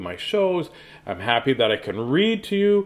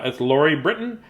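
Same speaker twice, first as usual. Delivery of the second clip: usual speaking voice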